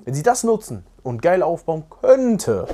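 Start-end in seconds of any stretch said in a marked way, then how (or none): 2.0-2.7 "könnte" stretched out with heavy emphasis; stress on KÖNNTE. Real, not acted. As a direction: lean hard on the word "könnte". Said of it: "die Betonung liegt auf könnte". (starke, gedehnte Betonung auf „könnte“)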